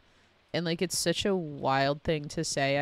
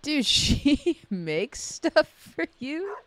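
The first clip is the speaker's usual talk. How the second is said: high, loud, stretched-out amazement; laughing, incredulous and drawn out; amazed reaction; 10 semitones higher, 7 dB louder, much slower than usual. Real, not acted. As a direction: marvel loudly, pitched way up. Amazed reaction to game gift.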